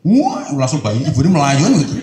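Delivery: loud, lively punchline; excited telling. Acted. Preacher loudly telling how the mother rushes over. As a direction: burst out with the payoff.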